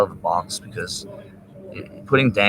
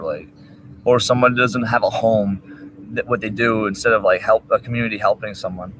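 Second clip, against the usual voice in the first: ordinary talk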